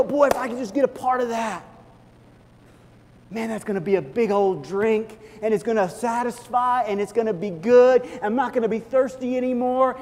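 long for a part of it; eager wishing. Retold or imitated. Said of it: "And we go".

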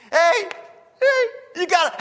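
high, loud mock call-out; imitated Elijah heckling. Elijah heckling Baal's prophets, high mock shout. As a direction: call across to them, hammed-up and high. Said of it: "sitting on a rock going".

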